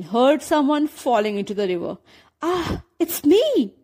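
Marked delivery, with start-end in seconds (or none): 2.4-3.7 surprised realization. A narrator's reading. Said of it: "she realized".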